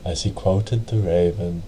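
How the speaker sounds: ordinary delivery, nothing marked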